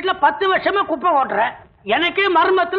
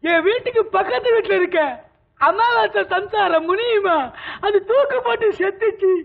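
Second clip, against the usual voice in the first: put-on drunken weeping lament, pitched up; crying; 3 semitones higher than usual. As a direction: wail through tears over a sad memory. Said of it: "அழுவுறேன்". (அழுது புலம்பும் குரல்)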